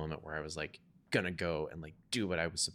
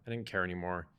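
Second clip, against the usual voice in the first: usual speaking voice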